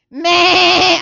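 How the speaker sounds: loud, high imitation bleat; sheep bleat. Shown as a demonstration. Pastor shows how little sheep sound, loud bleat. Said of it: "¿cómo es que hacen?".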